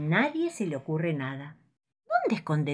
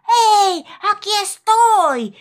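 elephant shouts much higher and louder, slow; calling down from the sky; 11 dB louder, much slower than usual. Acted. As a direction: call out from far above. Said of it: "una voz que les grita desde arriba".

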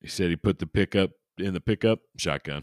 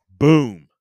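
mock boom; 6 semitones higher, 13 dB louder than usual. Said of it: "Mic drop".

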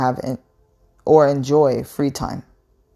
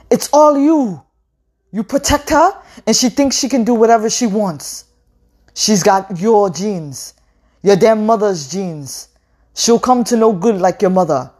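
high, loud ranting accusation; furious shouting; 7 semitones higher, 5 dB louder than usual. A narrator's reading. Father shouting at his wife about the daughter, loud furious rant. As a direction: rant at her, full of blame. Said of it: "as he kept shouting".

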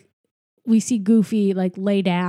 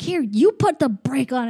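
high-pitched retold retort; mock bickering; 6 semitones higher, 4 dB louder than usual. Host retells dad and son fighting over brake, mock bickering. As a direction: imitate snapping back in a squabble, pitched up. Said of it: "still yelling at each other".

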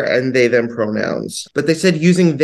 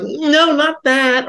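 mock dismissive no; 13 semitones higher, much slower than usual. Montage rejecting bad hat.

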